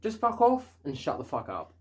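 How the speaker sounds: ordinary talk, nothing marked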